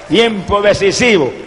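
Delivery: ordinary talk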